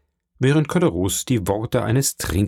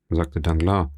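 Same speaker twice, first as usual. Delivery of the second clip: ordinary delivery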